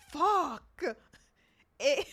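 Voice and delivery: ordinary talk, nothing marked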